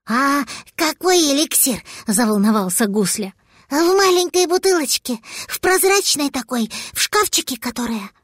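put-on high, anxious childlike voice; worried little dragon. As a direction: fret like a caught kid.

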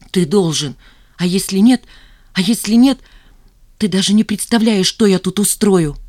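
pressing, combative ultimatum; threatening insistence. A narrator's reading. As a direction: build from firm demand to heated threat. Zhanna threatens him in a quarrel.

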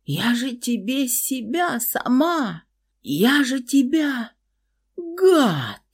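high slow hurt sobbing reproach; pleading through tears; tearful outburst. Acted. Girl in hysterics at betrayal.

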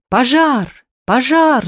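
high, loud, wildly gleeful yell; mad joyful shout. Acted. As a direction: shout with crazed joy. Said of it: "с безумной радостью кричал я".

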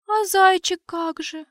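put-on high, slow, timid girlish plea; timid babble. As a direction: babble it meekly like a shy elf. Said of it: "пролепетала Аурелиэль".

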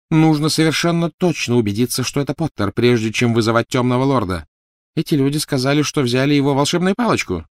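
high, clear, insistent woman's voice; cold, commanding wife. Acted. Voices Narcissa in the story. (высокий, холодный женский голос)

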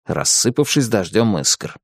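plain reading voice

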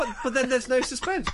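high-pitched, exasperated comeback; indignant protest. Real, not acted. Reviewer answers co-host's complaint about waiting, indignant protest.

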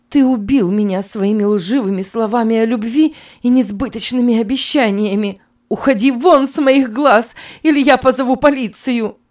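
high, loud, furious accusation; furious outburst. Acted. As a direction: fling the words right in his face. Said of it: "бросила ему эти слова прямо в лицо".

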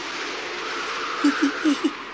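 breathy laugh held behind the hand; stifled laugh. A narrator's reading. Old Boonkham laughing quietly to himself, muffled. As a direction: giggle silently into your palm. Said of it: "ปิดปากหัวเราะเงียบๆ".

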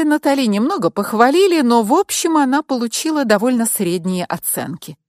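ordinary delivery, nothing marked

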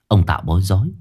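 ordinary delivery

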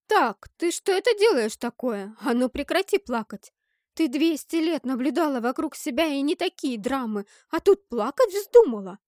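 loud, swooping indignation; scolding exclamation. A narrator's reading. Narrator ordering a crying girl to stop, loud indignant scolding. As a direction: tell the crying child off at full voice, pitch leaping up. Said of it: "воскликнула я".

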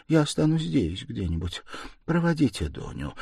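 near-despairing whisper. A narrator's reading. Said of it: "шептала она Разумихину чуть не в отчаянии".

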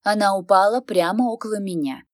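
ordinary delivery, nothing marked

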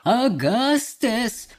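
drawn-out mild cry; Mr Wonka. A narrator's reading. Chocolate maker calls the boy, drawn-out cry. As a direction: call the name slowly, stretched out. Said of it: "кричал мистер Уонка".

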